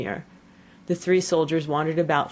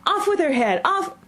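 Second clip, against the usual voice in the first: high screaming Queen of Hearts; shrill, furious royal screech; screamed order; 14 semitones higher, 4 dB louder than usual. A narrator's reading. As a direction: scream the command in a rage.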